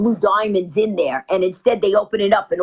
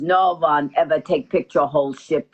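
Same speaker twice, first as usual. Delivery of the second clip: put-on low Russian-accented refusal; 6 semitones lower than usual. Russian pilot refusing the passenger's idea, low accented voice. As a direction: imitate a Russian pilot, pitched down. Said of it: "He goes".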